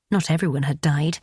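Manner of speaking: usual speaking voice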